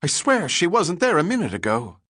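high, loud, startled report; young helmsman, high and excited; excited stutter. A narrator's reading. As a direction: blurt it out in a rush of alarm. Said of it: "he stuttered excitedly".

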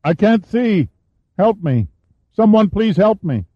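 high-pitched, desperate cry for help; narrator's high, acted scream; screamed plea. A narrator's reading. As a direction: cry out blinded and helpless. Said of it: "held his hands in front of him and screamed".

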